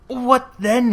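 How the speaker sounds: high, loud sharp retort. A narrator's reading. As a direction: fire the question back at her. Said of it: "he retorted".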